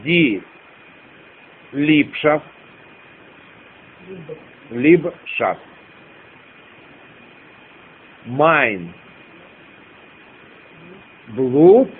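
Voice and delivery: isolated words with long gaps; slow dictation. Shown as a demonstration. Teacher dictating Yiddish verse to students, slow dictation. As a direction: give one word, then wait for the writers. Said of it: "я медленно продиктую".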